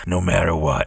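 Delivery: low, gravelly cowboy growl; Clint Eastwood voice. Retold or imitated. Host imitating Clint Eastwood's movie voice, low and gravelly. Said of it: "talking like this".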